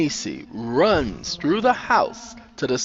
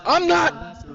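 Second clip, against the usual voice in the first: desperate stammer; 12 semitones higher than usual. A narrator's reading. Sandra outside fearing she is watched, desperate. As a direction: insist frantically, stumbling on words.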